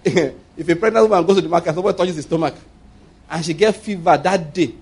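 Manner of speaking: ordinary delivery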